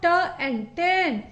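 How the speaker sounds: म and man stretched very slowly; slow phonic blending. Shown as a demonstration. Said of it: "टी का phonic sound ट".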